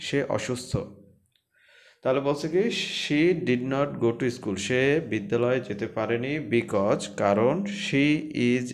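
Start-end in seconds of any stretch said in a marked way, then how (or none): 3.0-4.6 words said slowly and clearly; slow classroom dictation; slow reading. Shown as a demonstration. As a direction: read it slowly so students follow. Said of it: "teacher reads sentence slowly".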